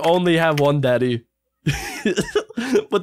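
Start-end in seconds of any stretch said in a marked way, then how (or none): 1.6-2.8 high soft laugh; falling high giggle. Real, not acted. Two daddies joke.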